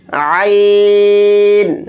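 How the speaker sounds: عین pressed from the throat, drawn slowly. Shown as a demonstration. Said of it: "عین کو حلق سے نکال کر ادا کریں گے". (عین حلق سے نکال کر)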